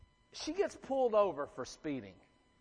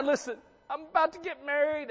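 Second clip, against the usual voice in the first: mock plea to cop; 8 semitones higher than usual. Pleading line suggested for cop.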